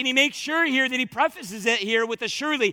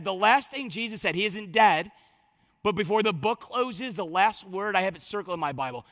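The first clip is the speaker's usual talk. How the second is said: ordinary talk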